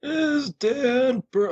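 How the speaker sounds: put-on slow, high, creaky old man. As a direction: drag it out like a feeble old man. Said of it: "that's my old man voice".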